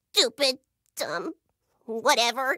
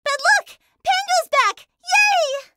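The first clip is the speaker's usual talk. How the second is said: excited cheer; 9 dB louder, slower than usual. Acted. Cheering the robot's return.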